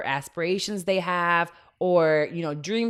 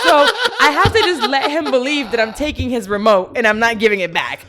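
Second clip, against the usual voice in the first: laughing through it; 6 semitones higher, 9 dB louder than usual. Real, not acted.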